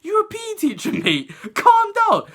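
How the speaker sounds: playful imitation of me answering back; mock cheeky retort. Talking back to teacher, high.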